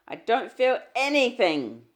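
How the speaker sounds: loud, high burst; almost shouted. A narrator's reading. Husband snapping at his pleading wife, almost shouting. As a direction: nearly shout it. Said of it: "he almost shouted".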